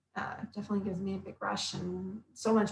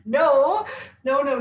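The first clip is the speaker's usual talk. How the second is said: louder, higher horrified no no no; dismayed no; 5 semitones higher, 6 dB louder, slower than usual. Retold or imitated. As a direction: stop her in mock horror. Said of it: "And I was just like".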